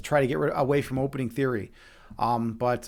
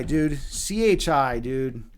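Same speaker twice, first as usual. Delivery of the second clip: ordinary talk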